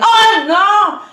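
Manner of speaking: high, loud startled cry; sudden shout. Acted. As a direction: burst out to scare her. La niña asusta de repente. (grito agudo y fuerte de sobresalto)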